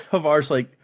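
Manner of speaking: usual speaking voice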